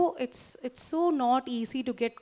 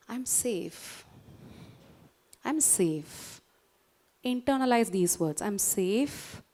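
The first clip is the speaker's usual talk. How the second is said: soothing affirmation; 4 semitones lower, 3 dB quieter than usual. Shown as a demonstration.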